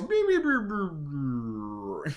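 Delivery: drawn-out descending mock beeping; imitated dying beeps. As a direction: beep like music winding down. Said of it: "the music is all playing nice, and it goes".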